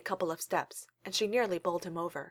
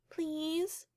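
put-on high babyish plea, much higher; 9 semitones higher, 4 dB quieter than usual. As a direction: play the littlest, most helpless child. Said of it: "putting on her most baby-like voice".

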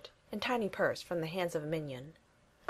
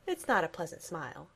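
ordinary delivery

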